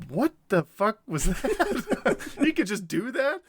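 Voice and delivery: high, drawn-out disbelief; comic stunned outburst; astonished. Acted. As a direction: gawp in amazement, stretching the words. Acted Pippin amazed at Gandalf.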